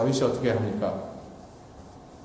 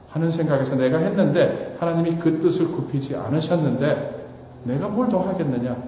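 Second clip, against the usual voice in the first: normal talking voice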